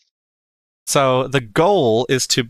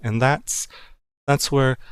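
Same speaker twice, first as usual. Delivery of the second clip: usual speaking voice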